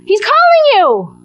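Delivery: high, bright hammed-up cheering on; rally him with sudden eagerness; eager encouragement. Crowd urging Bartimaeus to go to Jesus, high eager call. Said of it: "Those are all faithful translations".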